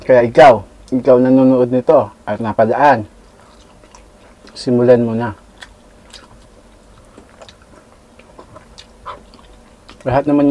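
chewing between words; talking while eating. Real, not acted. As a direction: bite taken mid-sentence. Seen 2: Holds food in fingers, bites at +2.3, chews while talking.

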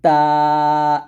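তা with tongue tip on upper teeth, light; a thin, light তা. Shown as a demonstration. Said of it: "তাটা পাতলা করে উচ্চারণ করব".

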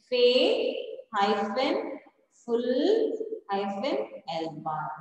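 slow, drawn-out "Faith" and "full" with "hyphen" between; split into syllables. Shown as a demonstration. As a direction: dictate "Faith", "full" as separate pieces. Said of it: "we have to split it".

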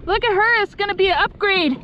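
high, thrilled look at this fish; excited. Real, not acted. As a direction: show off the catch. Admiring the fish she caught.

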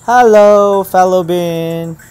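long, loud, high drawn-out hello; calling out a greeting. Real, not acted. YouTuber greeting a fellow on trying out VR video, calling out. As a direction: belt the greeting out slowly, pitch sliding down.

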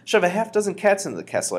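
high, loud, excited scheme; eager high-pitched shout; crying out. A narrator's reading. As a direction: pitch the plan at full excitement. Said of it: "I cried".

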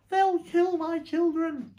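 high, loud, drawn-out wail; panicked mother crying out; frantic cry. Acted. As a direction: wail it slowly in terror. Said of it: "cried Missus Fox".

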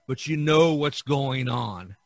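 usual speaking voice

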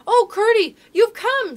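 high, loud, joyful cry; delighted greeting. A narrator's reading. Princess waking to find her friend has come, delighted.

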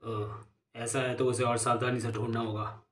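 soft, subdued gang boss; crime boss. Acted. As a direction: answer quietly, voice held down. Boss hears packet is lost.

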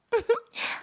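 put-on high soft girlish giggle. Shown as a demonstration. Demo for viewers, girlish giggle. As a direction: giggle like a shy young girl. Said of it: "I can speak like that".

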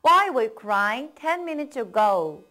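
slow, clear model sentence; slow model reading. Shown as a demonstration. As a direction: read it out slowly for learners to copy. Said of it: "다음입니다".

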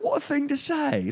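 mock the polite presenter's shock; Louis Theroux impression. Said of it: "Louis Theroux is just like".

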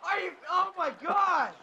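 loud, panicked gaming shouts; frantic yelling. Real, not acted. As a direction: yell at the players in a panic. Frantic yelling during the play.

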